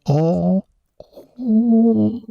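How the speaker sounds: whispered high ghostly moan. Retold or imitated. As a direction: breathe out eerie ghost moans. Imitating Lisa's moans.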